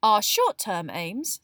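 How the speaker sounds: loud, slow, talking over someone. A narrator's reading. Girl reading out aims over friend's objection, loud. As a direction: press on loudly, ignoring objections. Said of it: "speaking even more loudly than Ron".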